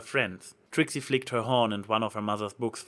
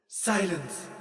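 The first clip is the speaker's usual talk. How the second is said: sharp, higher divine command; bellowing goddess; 7 semitones higher than usual. A narrator's reading. Goddess silencing Trixie, a lightly voiced bellow. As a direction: cut her off with a regal bellow. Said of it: "the goddess of the sun bellowed".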